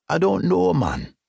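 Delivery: lightly voiced Jamaican driver; a hint of Jamaican lilt; reluctant Jamaican cabbie; Jamaican accent. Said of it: "with a deep Jamaican accent".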